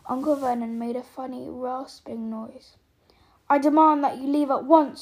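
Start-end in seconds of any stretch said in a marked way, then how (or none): 3.5-5.0 loud, indignant order; indignant demand. Acted. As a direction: demand he leave at once. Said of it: "he said".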